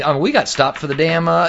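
usual speaking voice

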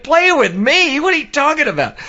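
very high, squeaky disbelief; mock incredulity. Grohl rejecting the compliment, high-pitched mock disbelief. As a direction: shoot the questions up high, baffled.

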